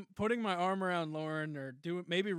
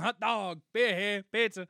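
vendor calls; 3 semitones higher, 5 dB louder than usual. Retold or imitated. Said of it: "Everyone else is going".

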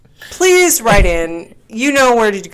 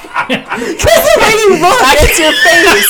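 high, loud, laughing speech; cracking up, pitched way up; helpless laughter; 11 semitones higher, 7 dB louder than usual. Real, not acted. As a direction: squeeze the words out between laughs. Helpless laughter at friend's face.

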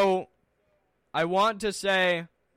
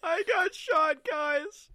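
mock crying; 11 semitones higher, much slower than usual. Whines after being shot.

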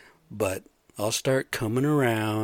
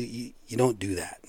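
ordinary talk

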